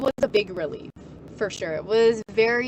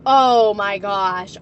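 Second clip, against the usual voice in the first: exasperated groan; 9 dB louder, much slower than usual. Real, not acted. Exasperated at mom.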